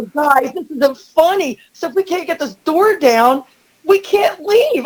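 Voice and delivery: high-pitched frantic protest; exasperated plea. Retold or imitated. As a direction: plead with laughing friends, rising in panic. Pleading with laughing friends.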